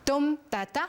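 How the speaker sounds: dum da da with the stress on dum; dactyl rhythm demo. Shown as a demonstration. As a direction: chant dum da da, heavy then two light. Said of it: "Versfødder med tre stavelser".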